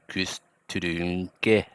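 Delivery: "kustrunke" said slowly, Saha style; Saha pronunciation. Shown as a demonstration. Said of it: "You see how it sounds really different".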